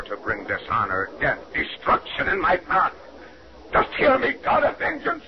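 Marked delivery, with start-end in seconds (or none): 3.7-5.2 high defiant cry to God; shouting at heaven. Acted. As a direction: hurl it up at the sky. Cursing heaven aloud.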